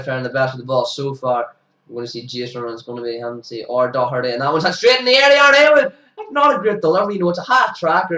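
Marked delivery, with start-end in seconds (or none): 4.3-8.1 high, loud shout of the catcher's name; real excitement, calming toward the end; excited call. Real, not acted. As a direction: burst out as the catch goes up. Calling a catch taken.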